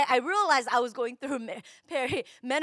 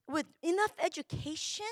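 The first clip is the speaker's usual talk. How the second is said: normal talking voice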